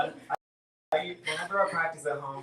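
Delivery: ordinary talk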